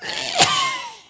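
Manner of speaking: a sharp, high, loud cough; high hacking cough. Acted. Lorax coughing from the smog, high hacking cough. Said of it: "please pardon my cough".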